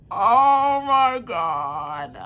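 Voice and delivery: very slow, stretched out in disbelief; slow awed disbelief; stunned drawn out. Real, not acted. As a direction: drag every word out slowly. Overwhelmed by the twist.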